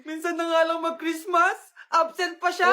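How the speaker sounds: high-pitched, hurt whining; upset complaint. Acted. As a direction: whine like a let-down kid. Upset over missing gift exchange. (nagmamaktol na parang bata)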